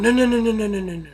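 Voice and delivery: flustered refusal. Retold or imitated. Hank flustered, refusing Walt's apology.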